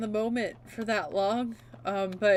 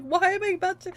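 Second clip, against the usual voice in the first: mock distressed; 8 semitones higher, 7 dB louder than usual. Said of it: "I'm like".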